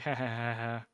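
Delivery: low-key mock giggle; little spoken-out ha-ha laugh; imitated giggling. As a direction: act out a drunken giggle, half-hearted. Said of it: "I'm just giggling, like".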